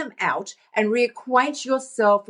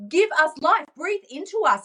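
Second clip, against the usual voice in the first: higher, lightly acted plea; mildly dramatic, raised-pitch appeal; dreams pleading; 7 semitones higher than usual. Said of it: "They're going".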